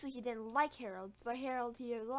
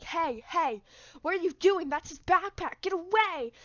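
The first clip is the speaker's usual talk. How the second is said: teacher yelling; 4 semitones higher, 6 dB louder than usual. Retold or imitated.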